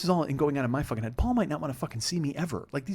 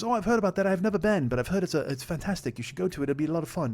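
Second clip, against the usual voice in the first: imitated English accent, starting high; [name]; 4 semitones higher than usual. Flatmate recommending the island, put-on English accent. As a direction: mimic an enthusiastic English flatmate. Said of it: "your English accent is shit".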